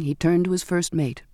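usual speaking voice

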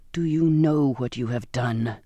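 low, slow, menacing captain; restrained, low-pitched hissed accusation; angry hiss. A narrator's reading. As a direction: hiss it slowly with contained fury. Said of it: "he said, his voice a hiss".

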